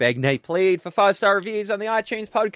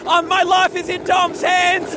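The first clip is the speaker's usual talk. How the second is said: scared; 8 semitones higher, 4 dB louder, slower than usual. Real, not acted. Terrified ride in pram.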